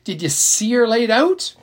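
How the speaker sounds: pushing for gory details with glee; excited. Acted. Little sister pressing Jess about the body, excited. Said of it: "he asked excitedly".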